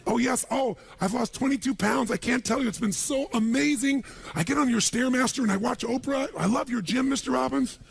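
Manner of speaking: high-pitched, gushing maid impression. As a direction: coo with over-the-top delight at the boss. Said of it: "And my maid, she's back at home in the castle going".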